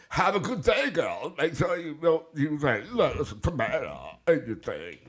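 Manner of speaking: high, goofy put-on voice; mock send-off in a high mumbled voice; new silly voice. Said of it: "that's my new voice right now".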